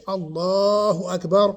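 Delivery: loud "Allahu Akbar" with very long A; "Allahu Akbar", hugely elongated and loud; over-stretched. Shown as a demonstration. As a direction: draw out the A of "Allahu" far too long, loudly. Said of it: "wouldn't be permissible to say".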